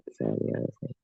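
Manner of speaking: hushed aside to self; whisper. Real, not acted. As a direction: whisper it under your breath.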